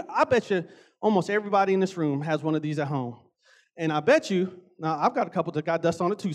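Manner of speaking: ordinary delivery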